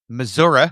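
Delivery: "Missouri" ending in "-uh"; local Missouri pronunciation. Shown as a demonstration. Host tries the guest's state as locals say it, "Missouri" ending in "-uh". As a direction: say "Missouri" as "muh-ZOOR-uh". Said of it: "as they say".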